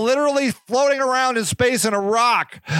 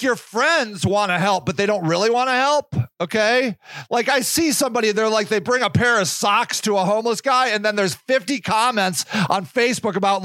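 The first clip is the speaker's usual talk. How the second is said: normal talking voice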